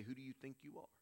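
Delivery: softly voiced self-doubt; discouraging inner voice. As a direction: drop the volume for a nagging inner doubt. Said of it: "this voice is going to say".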